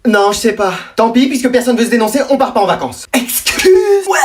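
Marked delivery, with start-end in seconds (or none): 3.1-4.2 very high-pitched disbelief; shocked outburst. Acted. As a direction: blurt it out, aghast. Reacts to cancelled holiday.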